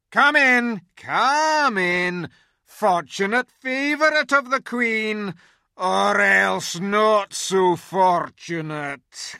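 loud, high, growling beast shout; growling wolf. A narrator's reading. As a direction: bark it out like a guard wolf. Wolf Morgrim speaks.

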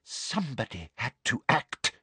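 soft menacing murmur; breathed. A narrator's reading. Professor admitting her secret deed, breathed. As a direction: breathe it softly. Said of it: "breathed Umbridge".

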